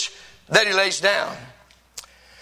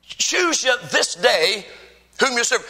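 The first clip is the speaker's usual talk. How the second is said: slow scripture quote; slower than usual. Acted. Preacher quoting Joshua slowly.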